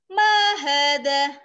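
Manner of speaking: hadza maktabun, each vowel drawn out; slow, loud teacher's model of hadza maktabun; slow vowelled reading. Shown as a demonstration. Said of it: "sambil diharokati". (hadza maktabun dibaca pelan dengan harakat)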